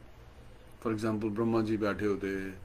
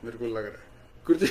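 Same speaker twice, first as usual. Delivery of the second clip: ordinary delivery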